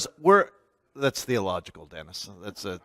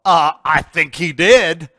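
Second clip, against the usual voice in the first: higher, louder pushback; loud retort; 5 semitones higher, 10 dB louder than usual. Retold or imitated. Garlow contradicting the guide, loud and firm. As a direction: cut in firmly with a loud correction. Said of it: "Garlow's all".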